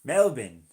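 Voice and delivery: "Melbourne" as a loud MEL-bin; local pronunciation. Shown as a demonstration. Streamer practising for viewers, emphatic "Melbourne" as MEL-bin. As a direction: hit the first syllable of "Melbourne" hard, short "bin". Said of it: "That's how you say it?".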